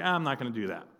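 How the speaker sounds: low, soft imitation of giving up; mock frustrated quitter. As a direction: mimic her quietly giving up. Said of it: "she'll get frustrated and be like".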